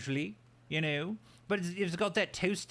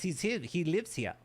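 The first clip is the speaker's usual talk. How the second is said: ordinary talk, nothing marked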